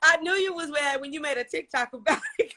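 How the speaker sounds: normal talking voice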